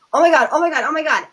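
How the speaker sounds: imitating a startled boyfriend's alarmed cry; retold panic. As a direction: imitate him freaking out.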